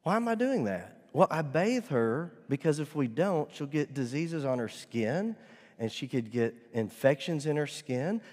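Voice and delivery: usual speaking voice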